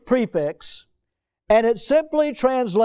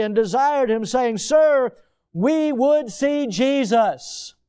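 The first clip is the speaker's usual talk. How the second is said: raised, solemn reading of the verses; scripture reading; 5 semitones higher than usual. Acted. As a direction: read the verse like a preacher in the pulpit. Reads scripture aloud.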